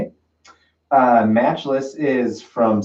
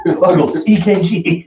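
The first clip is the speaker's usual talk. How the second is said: "Fuggles, E K G" laughed out, higher, in a mock British accent; laughing repeat; 6 semitones higher, 5 dB louder, slower than usual. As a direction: giggle through "Fuggles, E K G" mocking his own British accent. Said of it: "Wow, that was intense".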